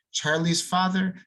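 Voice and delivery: plain reading voice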